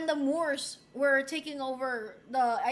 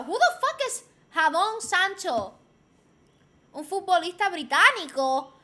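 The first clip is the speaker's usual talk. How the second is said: ordinary delivery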